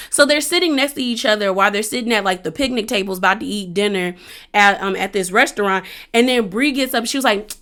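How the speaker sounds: ordinary talk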